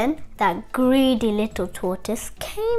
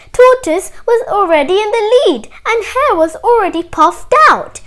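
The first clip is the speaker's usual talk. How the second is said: ordinary delivery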